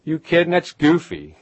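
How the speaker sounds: imitating loud, high-pitched disbelief; scoffing neighbours. Hometown crowd discouraging a would-be writer, scoffing. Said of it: "It was like, you know".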